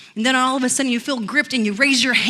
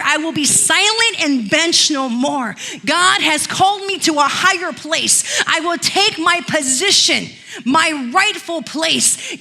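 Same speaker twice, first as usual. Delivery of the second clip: bold declaration; 4 semitones higher, slower than usual. Pretend. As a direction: declare it like a battle cry. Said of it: "the year that you declare".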